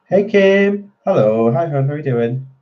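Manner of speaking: normal talking voice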